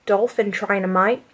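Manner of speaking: low, deadpan mock Aussie; fake Australian accent. Friend inventing a job for the stranger, low bad Australian accent. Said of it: "Meg starts talking in an Australian accent".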